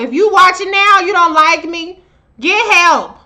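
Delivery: loud, scolding call to anyone hating; calling out haters. Real, not acted. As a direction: raise your voice at the haters.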